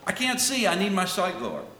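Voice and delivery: high-pitched, acted cry for help; urgent plea. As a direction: plead urgently, starting high and easing down. Acting out blind man's honest plea.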